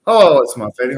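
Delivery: usual speaking voice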